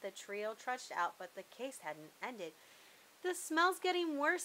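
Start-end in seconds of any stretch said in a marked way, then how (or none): 3.2-4.4 a hint of a shivery worried voice, higher; mildly nervous inspector; light shiver. A narrator's reading. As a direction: shudder at the smell. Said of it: "said Croissant with a shiver".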